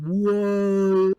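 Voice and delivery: high, loud startled whoa; mock-frightened whoa from a bouncing boat. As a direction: squeal it out like she's on a wild ride. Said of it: "Like, fucking".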